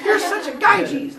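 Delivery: high, slow taunting sibling voice; mock insult. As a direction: tease a little brother slowly and high. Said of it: "You can, like".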